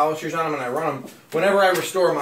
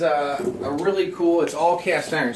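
ordinary delivery

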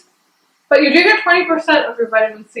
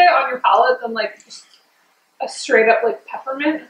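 talking around frozen pop; freezie at mouth; 3 dB quieter than usual. Real, not acted. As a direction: lips on ice pop. Seen 3: She holds the freezie tube at her lips while talking.

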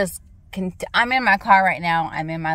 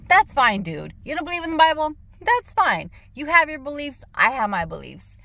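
retold sarcastic reply; 8 semitones higher than usual.

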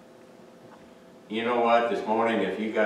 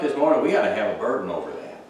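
ordinary talk

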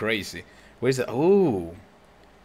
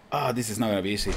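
ordinary talk, nothing marked

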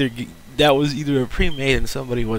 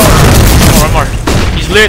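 excited yell; 7 semitones higher, 15 dB louder, slower than usual. Real, not acted. Yelling during a clutch round.

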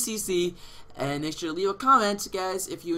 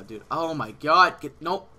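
normal talking voice

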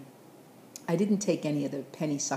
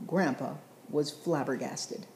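ordinary delivery

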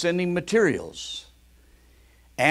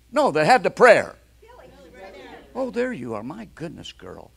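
usual speaking voice

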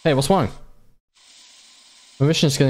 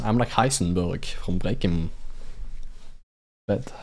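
low mock boast; 9 semitones lower, 7 dB quieter, slower than usual. Boasting as a master chemist.

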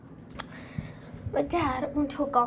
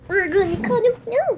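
cooing to dog; 6 semitones higher, 5 dB louder than usual. Real, not acted.

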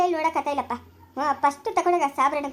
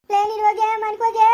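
louder, higher angry yell; wife yelling at the new bride to go home; angry shout; 3 semitones higher, 5 dB louder than usual. Acted. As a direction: drive her out of the house.

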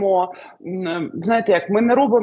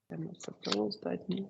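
barely audible, quick, repeating the question to herself; muttered to herself; 16 dB quieter, faster than usual. Real, not acted. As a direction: say it softly to yourself, as if thinking aloud. (тихо, сама до себе)

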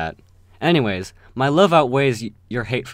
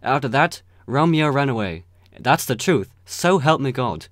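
ordinary delivery

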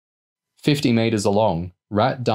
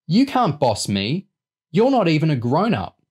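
put-on defiant kid; 8 semitones higher than usual. A narrator's reading. Young boy refusing to be bossed, defiant. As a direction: talk back like an indignant little boy. Boy talks back to older girl.

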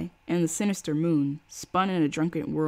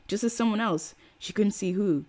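plain reading voice

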